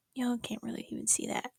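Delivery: soft whisper to camera; hush, don't wake him; whispered aside. Real, not acted. Whispering near sleeping pet.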